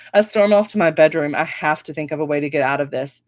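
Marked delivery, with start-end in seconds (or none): none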